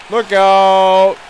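ordinary talk

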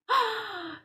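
long, high gasp of wonder; surprised gasp. Pretend. Teacher reveals the next fruit, surprised gasp.

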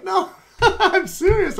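high, slow, laughing through it; laughing excitedly. Retold or imitated. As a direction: laugh while insisting, voice soaring. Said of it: "I'm like".

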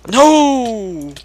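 loud high cry of no; shout. Real, not acted. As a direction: yell in real alarm. Friend falls in lava.

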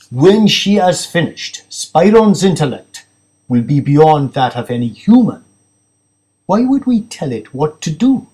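proud of the machine, a touch removed from the narrator's own voice; hint of an aloof scientist; lightly voiced cold technologist.

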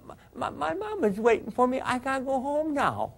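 put-on high, small child's voice, pleading; little girl's voice. Cop voicing dying Joni begging to go home, high little girl's voice. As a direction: plead like a scared little girl. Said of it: "a little girl's voice".

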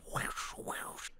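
soft whispery whooshes; voiceless mouth-made whoosh; imitated wave noises. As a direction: blow out whooshes like energy waves. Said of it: "making wave noises with their mouth".

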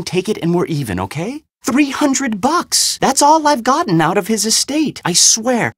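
urgent bargaining. Acted. Offering the glass eye in exchange, urgent bargaining.

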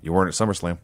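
low, soft imitated aside; retold quiet dig; under his breath. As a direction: drop it way down, barely voiced. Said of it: "says under his breath".

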